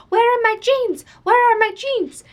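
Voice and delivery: high, loud mock demand; loud demanding woman. Loud Filipino woman demanding her jeans at the store counter, high mock yelling. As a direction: imitate a pushy shopper hollering. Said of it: "and she's loud".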